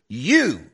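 YOU punched high and drawn out; emphatic. Shown as a demonstration. Preacher points at listener to show Greek emphasis, emphatic YOU. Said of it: "that's what we call emphatic".

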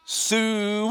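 high, loud drawn-out hog call; loud high farm call. Retold or imitated. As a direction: call the cows home from far off. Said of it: "she goes".

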